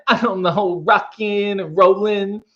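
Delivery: put-on movie-star impression, words drawn out; John Travolta. Shown as a demonstration. Answering a viewer's request, drawn-out Travolta impression. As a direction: stretch it into a cool, loose accent. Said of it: "an immaculate John Travolta accent".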